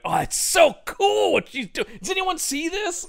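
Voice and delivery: a camera guy gushing at the actress, hammed-up excitement; excited fanboy. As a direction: shout it like a thrilled fan behind the camera. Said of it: "Lance behind the camera going".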